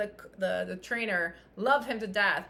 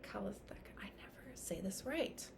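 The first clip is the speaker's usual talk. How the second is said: unvoiced whisper, trying the word under her breath; embarrassed muttered whisper; whispered to herself; 4 semitones lower, 16 dB quieter than usual. Real, not acted. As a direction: whisper it aside, half to yourself. Stumbling over a hard word.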